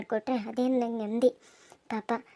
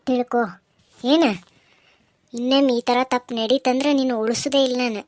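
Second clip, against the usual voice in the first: loud, stern warning hammered out; loud threat; 7 dB louder than usual. Acted. Aunt warning Kamalavva never to touch her girl again, loud threat. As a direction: lay down the law, threatening.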